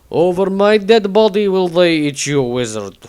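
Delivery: Tordrad voice. Acted. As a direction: declare it loudly like a proud warrior. Tordrad character voice.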